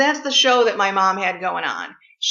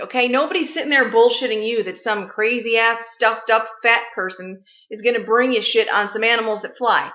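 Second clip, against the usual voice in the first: ordinary talk, nothing marked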